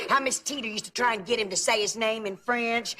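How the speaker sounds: usual speaking voice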